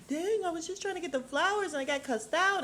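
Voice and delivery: put-on high, put-upon kid's voice; imitating a hurt teen. Jax complaining to his mom after being cussed out, high and put-upon. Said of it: "he's like".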